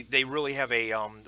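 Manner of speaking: ordinary talk, nothing marked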